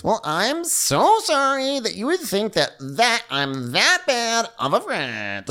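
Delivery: very high, slow, whiny parody; mock Lindsay. Co-host mocks Lindsay's fake apology, high slow whine. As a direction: drag it out like a wounded martyr.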